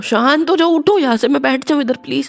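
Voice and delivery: loud, high, strained with pain; pained urgency. Acted. Injured husband begging to sit down, loud and strained. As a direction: push the words out through the hurt.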